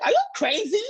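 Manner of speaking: put-on high, incredulous girlfriend; bestie's voice. Bestie hearing about the laptop, high disbelief. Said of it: "she's like".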